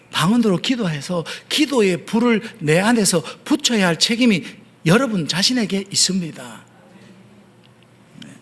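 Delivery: ordinary delivery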